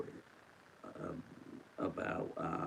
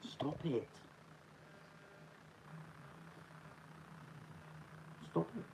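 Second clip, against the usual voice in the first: high playful scold; 10 semitones higher, 12 dB quieter than usual. Real, not acted. Talking to the dog.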